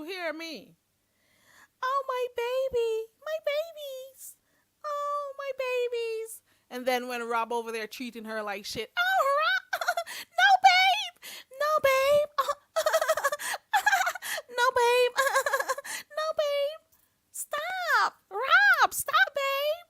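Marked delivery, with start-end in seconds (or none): 1.8-6.4 very high, slow, syrupy imitation; mock cooing voice. Mocking the same woman again. 9.0-19.9 very high, loud, giggling fake protest; mock giggly girlfriend. Mocking her with [name].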